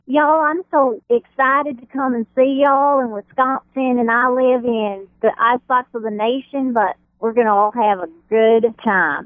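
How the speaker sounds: exaggerated American Southern accent. Shown as a demonstration. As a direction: play up a cheerful Southern accent. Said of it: "my Southern will be".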